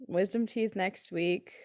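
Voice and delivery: ordinary talk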